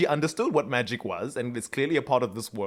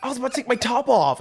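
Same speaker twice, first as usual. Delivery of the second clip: quick, higher mock of Liv; 9 semitones higher, faster than usual. Sister snaps at brother walking in, quick. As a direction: rattle it off like a huffy sister. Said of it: "She's like".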